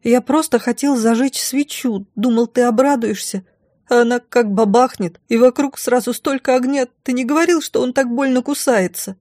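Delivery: put-on high-pitched little boy's voice; high childish pleading; frightened prince babbling. A narrator's reading. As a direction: explain like a scared, guilty child. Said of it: "испуганно пролепетал принц".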